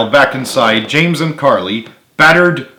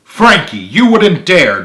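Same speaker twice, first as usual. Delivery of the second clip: higher, louder alarm; alarmed cry; 7 semitones higher, 6 dB louder than usual. A narrator's reading. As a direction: plead in shock. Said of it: "Joey cried".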